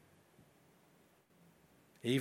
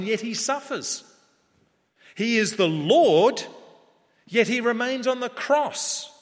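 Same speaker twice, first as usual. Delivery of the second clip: higher, ringing pulpit delivery with loud peaks; full-force preaching; 7 semitones higher, 6 dB louder than usual. Real, not acted. Preacher proclaiming the thief's faith to a congregation, impassioned. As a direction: drive each paradox home with rising fervour.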